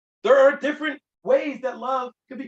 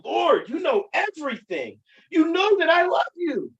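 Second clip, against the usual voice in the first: louder and higher, a hurt, pleading answer; grieved plea; 6 semitones higher, 5 dB louder than usual. A narrator's reading. Peter, grieved at the third asking, answers in a louder, pleading cry. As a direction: pour out the wounded protest, voice lifting. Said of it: "Peter was grieved".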